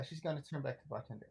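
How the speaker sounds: normal talking voice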